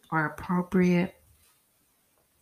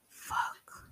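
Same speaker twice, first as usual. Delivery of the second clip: breathy whispered curse under the breath; 10 dB quieter than usual. Retold or imitated. After her friend hangs up, a whispered curse. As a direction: let it slip out as a whisper.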